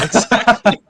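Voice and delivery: loud laughing exactly. Real, not acted. Mocking the whining guys, loud and laughing. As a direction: bark it out through a laugh.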